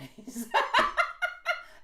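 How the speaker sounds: loud high giggle; real amused laughter. Real, not acted. Laughing at her own joke.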